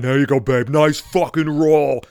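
slow, loud, oafish mock cheer; idiot voice. Boyfriend delivering his line after her strike, idiot voice. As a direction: hoot it like a proud, dim boyfriend. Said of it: "he said it like a fucking idiot".

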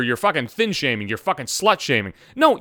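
ordinary talk, nothing marked

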